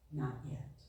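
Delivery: low, hushed, patient reply; quiet refusal. A narrator's reading. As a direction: drop right down, soft and low. Master calmly refuses the pleading clay.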